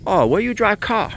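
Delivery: high, put-on Japanese accent as the engineer. Retired Toyota engineer quizzing the owner, high mock Japanese accent. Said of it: "Sorry for the accent".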